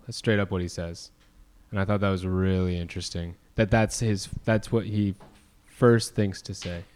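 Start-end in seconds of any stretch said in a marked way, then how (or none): none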